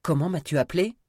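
loud, high angry demand; outraged challenge. Acted. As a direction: dare him to repeat the insult. Insulted gunman's menacing challenge.

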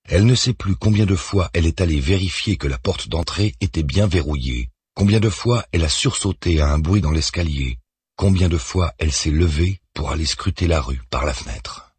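ordinary delivery

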